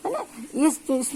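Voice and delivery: ordinary talk, nothing marked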